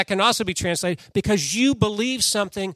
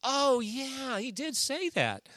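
lightly voiced women recalling, higher and softer; higher, soft, slightly slowed "oh yeah" of sudden recall; remembering women; 6 semitones higher, 8 dB quieter, slower than usual. Women at the tomb recalling Jesus' words, with soft, higher surprise. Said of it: "They're like".

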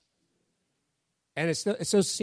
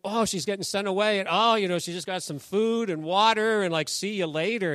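higher, lightly mocking protest; mock indignation; 5 semitones higher than usual. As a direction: play up the unfairness, half in jest. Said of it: "And you're like".

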